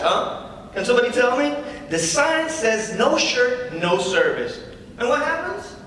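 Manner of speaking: lightly voiced comedy-club patter; stand-up comic. [name] opening his set to the crowd, casual comic patter. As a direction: work the crowd with an easy opener.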